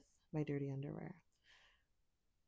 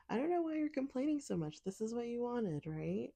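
high-pitched mock exasperation; teasing scolding; 6 semitones higher, 3 dB louder than usual. To a complaining partner, teasing scolding. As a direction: scold playfully, pitched well up.

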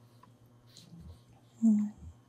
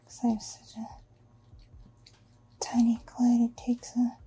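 whispering. Acted. Intimate whispered fantasy.

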